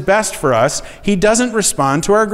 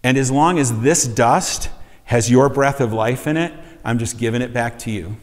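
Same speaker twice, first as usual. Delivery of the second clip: soft prayer; 5 semitones lower than usual. Shown as a demonstration. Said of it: "I've learned to pray this prayer".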